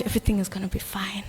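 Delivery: whispered quickly under the breath; fast mocking whisper. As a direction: hush it like a secret reassurance. Mocking a devotee's self-talk.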